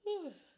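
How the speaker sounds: big audible exhale, high and loud; releasing tension with a loud sigh. Acted. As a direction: let out a long heavy sigh. Deep breath during tapping.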